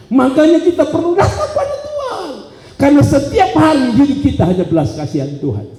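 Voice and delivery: high, driving sermon delivery; forceful preaching. Acted. Preacher urging the congregation to realise why he seeks God, forceful.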